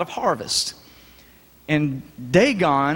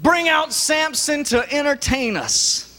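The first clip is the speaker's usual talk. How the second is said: pitched high and slow, a mock jeering call; mock crowd shout; 9 semitones higher, slower than usual. Philistine crowd jeering for Samson, mock high call. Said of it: "they shouted".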